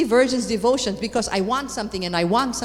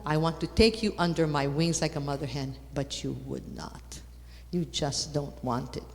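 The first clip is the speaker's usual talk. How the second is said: tender lament; 6 semitones lower, 6 dB quieter than usual. Retold or imitated. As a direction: grieve quietly over those who refused. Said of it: "He makes himself a woman".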